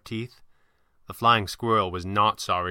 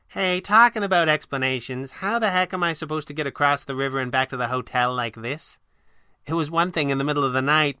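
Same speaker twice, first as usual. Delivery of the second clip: higher-pitched sidekick, indignant; higher voice, exasperated protest; feisty heroine; 5 semitones higher than usual. Acted. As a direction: complain as the spirited sidekick. Voices the Flying Squirrel.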